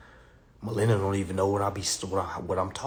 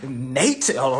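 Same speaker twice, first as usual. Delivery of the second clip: usual speaking voice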